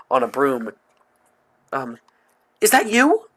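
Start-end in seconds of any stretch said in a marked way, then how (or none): 2.6-3.3 put-on high, loud, worried girl. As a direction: play her anxious and high. Worried friend voices character.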